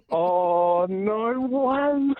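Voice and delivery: long, drawn-out rising amazement; awestruck disbelief. Real, not acted. Caller realising the race trip is his, awestruck disbelief. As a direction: let each word stretch out, overwhelmed.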